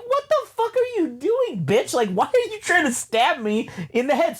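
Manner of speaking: high, panicked outrage; mock panic. Telling a friend about a robot attack, in high mock panic. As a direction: yelp it like you're being stabbed.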